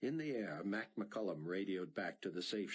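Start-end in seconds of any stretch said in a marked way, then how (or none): none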